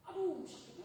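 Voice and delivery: high "aduh" of pain; retold cry of pain. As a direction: wince aloud, pitched high. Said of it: "sakitnya bukan main".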